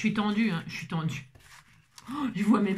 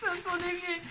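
mock squeaky voice; 8 semitones higher, 6 dB quieter than usual. Mock sniffing joke, high far.

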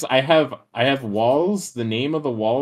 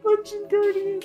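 high crying shriek of fright; scared horror game streamer. Real, not acted. Jump scared by monster.

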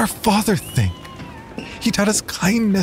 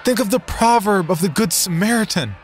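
high, raised preaching appeal; earnest raised appeal to the crowd; 11 semitones higher, 3 dB louder, faster than usual. Acted. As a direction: call them back to their faith. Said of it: "I regaled them".

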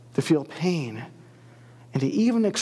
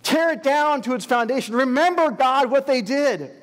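high, loud furious cry; 7 semitones higher, 4 dB louder than usual. Acted. Exiles cry to God to destroy Edom, loud high furious cry. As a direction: shout for the city's ruin. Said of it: "they cried".

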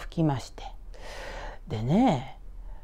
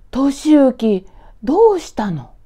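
high, loud surprised greeting; a mother startled by her son's call; 8 semitones higher, 10 dB louder, slower than usual. Acted. Mother answering her son's phone call, surprised and loud.

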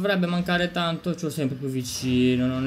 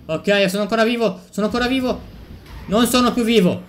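higher and louder, building excitement; thrilled to survive, then crashing; excited disbelief; 8 semitones higher, 5 dB louder than usual. Real, not acted. Sopravvive poi si schianta. (eccitazione incredula, acuta e forte)

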